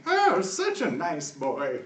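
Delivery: put-on slow, high old lady coo; sweet old lady voice. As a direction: coo it like a doting granny. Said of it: "this awkward shuffling and".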